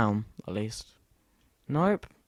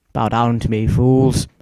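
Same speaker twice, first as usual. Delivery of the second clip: dark lord; 11 dB louder, slower than usual. Pretend. Goat boasts to villagers.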